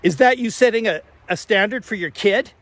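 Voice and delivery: accusing question thrown after a cyclist; retold yelling. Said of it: "he yells out after me".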